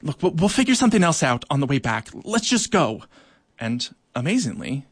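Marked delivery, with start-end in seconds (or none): 0.0-3.0 hurried urging. Acted. Eartha urging the others to go.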